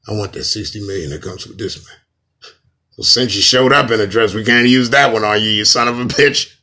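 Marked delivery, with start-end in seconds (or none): 3.0-6.5 loud mock secret-society boss; hammed-up, booming conspiracy boss; Illuminati voice. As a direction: project it as the scolding Illuminati spokesman. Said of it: "Okay, Illuminati voice".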